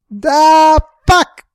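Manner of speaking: high, loud startled yell. A narrator's reading. Pat yelling as the lighter burns his neck, loud and high. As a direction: cry out in pain and shock. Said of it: "Pat yelled".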